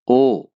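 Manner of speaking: ओ, loud rounded oh; German O. Shown as a demonstration. Teacher calling German letter O, loud clear. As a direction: call out ओ clearly. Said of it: "ओ को भी ओ ही बोलेंगे".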